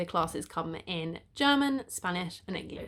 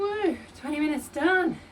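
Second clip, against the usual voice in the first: excited; 7 semitones higher, 5 dB louder than usual. Real, not acted. Finished the ride, cheering.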